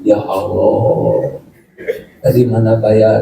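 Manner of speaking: slow, low mock prayer; a worried little prayer, drawn out. (doa cemas yang dibuat-buat, pelan)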